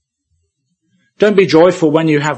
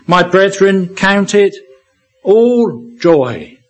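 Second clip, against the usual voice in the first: "all" set apart by a pause and hit firmly; raised, deliberate reading that lands on "all"; stress on ALL; 5 semitones higher, 3 dB louder, slower than usual. Shown as a demonstration. Preacher urging believers to hear the verse, stressing "all". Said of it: "Emphasize that word".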